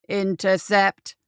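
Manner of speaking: slow, loud grinding robot command; grating machine voice. Acted. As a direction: grate it out like a war machine. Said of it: "it grated".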